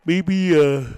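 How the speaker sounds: word stretched through a yawn. Real, not acted. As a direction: say it mid-yawn. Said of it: "sorry for the yawn".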